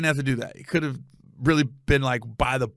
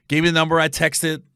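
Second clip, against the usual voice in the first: usual speaking voice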